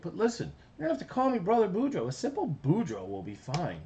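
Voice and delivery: cartoon big brother voice; Boudreaux voice. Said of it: "And he says".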